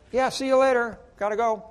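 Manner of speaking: hammed-up dismissive goodbye; brushing off. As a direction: wave him off and hurry away. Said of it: "I'm like".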